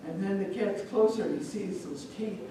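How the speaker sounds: plain reading voice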